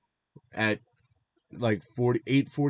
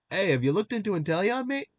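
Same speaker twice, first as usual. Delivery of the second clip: a high, hammed-up Australian accent; mock Australian, pitched well up and a little louder; bad Australian accent; 11 semitones higher, 3 dB louder than usual. A friend's tip retold in a bad Australian accent. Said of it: "That's my Australian accent".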